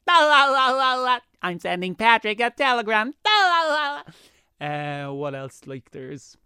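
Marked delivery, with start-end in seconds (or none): 0.1-4.0 high, loud, sing-song cartoon sponge; squeaky cheerful cartoon singsong; SpongeBob voice. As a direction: chirp it like an overexcited cartoon sponge. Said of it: "SpongeBob's like".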